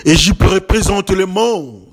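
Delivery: ordinary talk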